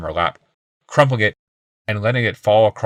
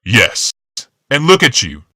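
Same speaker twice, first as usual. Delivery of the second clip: louder angry retort; shout; 4 semitones higher, 7 dB louder, slower than usual. A narrator's reading. Rival shouting at the husband, angry shout. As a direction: fire it back standing up. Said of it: "Yawmoth shouted".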